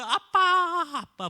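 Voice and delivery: high, loud wailing call; boy dragged away, wailing for his father; sobbing call for dad. Retold or imitated. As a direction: cry out for dad through tears. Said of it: "엉엉 울면서".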